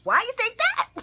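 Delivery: high-pitched, loud mimicked question; mock questioning. Laura questioning Curtis, mimicked in a high mocking voice. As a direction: put on a high, incredulous squeal.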